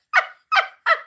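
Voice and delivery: loud high-pitched squealing laugh. Real, not acted. Laughing fit mid-reading.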